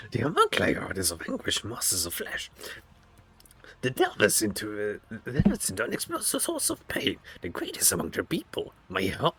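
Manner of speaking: high, eerie surgeon creature voice; creepy homunculus voice. Acted. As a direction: voice a gloating flesh-crafter, high and strange. Reads homunculus lines in game.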